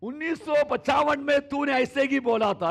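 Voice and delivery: high, loud hammed-up grudge; mock accusing shout. Grudge-holder yelling at spouse about an old quarrel, mock angry shout. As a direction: throw the old complaint back at them.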